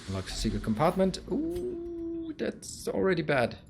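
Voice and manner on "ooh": surprised. Real, not acted. Reacts to chest loot.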